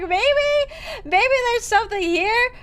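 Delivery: high, giggly self-quote; laughing. Retold or imitated. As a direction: laugh through the hopeful words. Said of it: "I was like".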